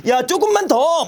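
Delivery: high, eager push; urging on. Pretend. Teacher urging a tired friend to keep going, high and eager. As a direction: egg them on, pitched high.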